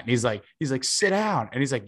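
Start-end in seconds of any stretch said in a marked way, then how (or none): 0.8-1.5 higher, snappy sit-down command; mock heckler order. Heckler repeating his order to sit down, higher mock bark. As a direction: toss it off like the annoyed fan.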